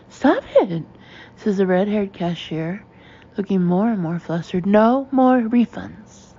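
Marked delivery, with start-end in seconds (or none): 0.1-0.8 higher, louder sharp protest; flustered. A narrator's reading. Red-haired cashier stopping the refund wave, flustered and loud. 4.6-6.3 slow, loud, higher decree; flustered. A narrator's reading. Cashier refusing all customers, slow flustered shout.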